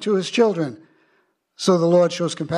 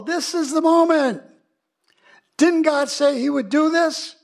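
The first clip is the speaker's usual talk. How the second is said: Caleb; 9 semitones higher than usual. Retold or imitated. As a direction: urge a timid crowd into battle. Caleb acted, rallying the people.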